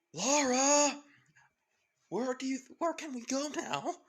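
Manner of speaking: high, loud frightened call to Lara; boy calling out in alarm over gunfire; panicked calling. Acted. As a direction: shout for help, scared and high. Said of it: "grabbing out the pistol saying".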